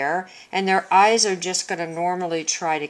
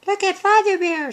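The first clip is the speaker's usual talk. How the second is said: much higher storybook character voice; Mother Bear; 13 semitones higher, 3 dB louder than usual. Shown as a demonstration. Teacher showing voice change for speech marks, Mother Bear voice. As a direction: pitch it way up as a bear character speaking. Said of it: "sound like Mother Bear and Baby Bear".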